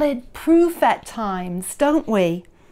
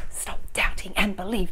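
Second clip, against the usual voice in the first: unvoiced whisper, quick; whispered mantra; 5 semitones lower, 6 dB quieter, faster than usual. Shown as a demonstration. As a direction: breathe the mantra softly. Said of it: "couldn't that be a mantra for us".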